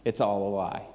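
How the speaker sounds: imitating a flat voice, low and soft; low, subdued delivery drained of enthusiasm; emotionless. Waitress confessing what she told her boyfriend, emotionless. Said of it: "no enthusiasm, no emotion whatsoever".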